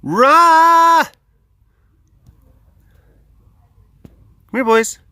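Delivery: loud high call; excited shouted call. Real, not acted. Calling lions.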